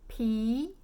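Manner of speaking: "P" as a long rising "pee"; second tone. Shown as a demonstration. Teacher recalls word for beer, rising second tone. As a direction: lift "P" upward in second tone. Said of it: "Second tone".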